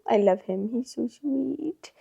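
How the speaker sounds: slow, soft whisper; whispered coo. Real, not acted. Owner doting on her cat, slow soft whisper. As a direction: breathe it out slowly, doting.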